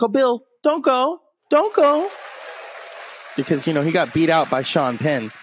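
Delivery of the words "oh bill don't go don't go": high pleading mock voice, much higher; Billy Crystal. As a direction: beg him to stay in a high whiny plea. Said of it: "Billy Crystal's like".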